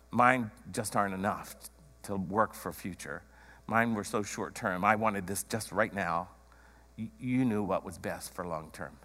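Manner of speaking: low, soft, resigned to God; surrendering prayer. Acted. Praying to Jesus after giving up own plans, quiet surrender. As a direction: hand it over quietly, voice dropped low.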